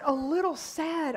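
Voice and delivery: high, loud, slow disbelief at A little sad; incredulous. Retold or imitated. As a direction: stretch out A little sad like you can't believe it. Incredulous retort to mother.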